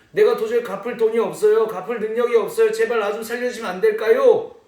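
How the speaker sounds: 목소리를 높여 절박하게 애원; desperate plea. Acted. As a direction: beg for your life, voice rising. Servant begging the king for mercy.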